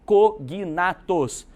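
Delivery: "cognatos" broken slowly into separate syllables; emphatic key term. Shown as a demonstration. Teacher asks class to note this term, "cognatos" slowly syllable by syllable.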